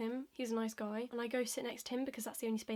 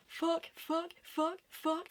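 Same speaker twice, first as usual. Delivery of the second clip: high, evenly repeated alarm beeps; piping-up alarm; 6 semitones higher, much slower than usual. Acted. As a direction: chant it like a high warning signal. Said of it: "piping up and being like".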